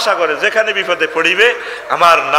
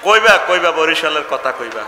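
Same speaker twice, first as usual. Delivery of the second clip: usual speaking voice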